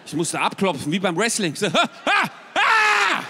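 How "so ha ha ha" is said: loud high pained gasps; mock pained yelps, much higher and louder. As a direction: cry out under the massage. Screaming during hard massage.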